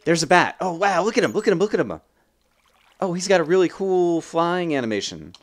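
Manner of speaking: high, loud, gushing amazement; excited delight. Real, not acted. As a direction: urge everyone to look, thrilled. Delighted at seeing a bat.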